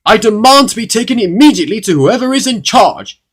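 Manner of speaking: high, very loud indignant demand; angry. A narrator's reading. Mister Uppity demanding whoever is in charge, angry shout. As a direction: bellow it like an outraged bigwig. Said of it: "said Mister Uppity angrily".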